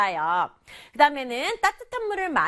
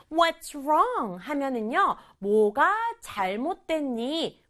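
normal talking voice

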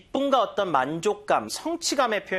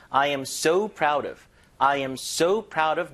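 "so" stretched and stressed, slowly; much slower than usual. Shown as a demonstration. Teacher demonstrating where the stress goes, "so" stressed. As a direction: give "so" the main beat. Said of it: "강세를 so에 조금 주세요".

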